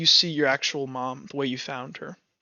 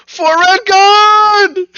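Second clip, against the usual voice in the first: huge drawn-out war cry, much higher; battle cry; 11 dB louder, much slower than usual. Acted. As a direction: roar it like a charging warrior.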